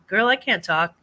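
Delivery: ordinary talk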